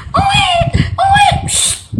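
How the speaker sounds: very high mock baby crying owe; baby wail. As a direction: wail like a newborn, high and thin. Baby cry then hush.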